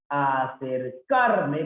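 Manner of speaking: Acercarme drawn out slowly; slow reading. Shown as a demonstration. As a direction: read the word slowly to find the stress. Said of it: "palabra grave".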